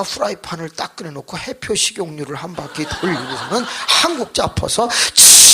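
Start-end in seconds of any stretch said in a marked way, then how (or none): 5.1-5.5 long loud hiss; imitated frying sizzle. Wife frying chive pancake, long vocal sizzle. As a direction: hiss like batter hitting hot oil.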